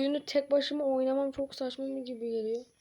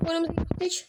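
ordinary delivery

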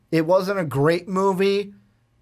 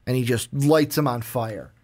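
normal talking voice

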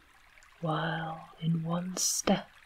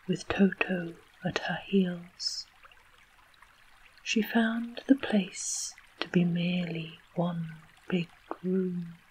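ordinary delivery, nothing marked

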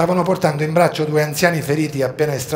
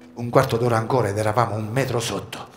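ordinary delivery

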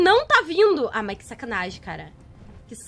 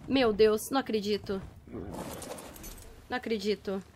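low, soft, deflated voice; stunned disbelief; 8 semitones lower, 10 dB quieter than usual. Real, not acted. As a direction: say it quietly, barely believing it. Stunned after the attack.

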